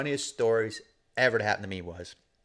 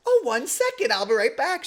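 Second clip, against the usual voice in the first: much higher, sugary mock old lady; sweet old lady; 13 semitones higher, 4 dB louder than usual. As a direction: chirp it like an eager granny. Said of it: "She goes".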